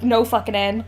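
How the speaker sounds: usual speaking voice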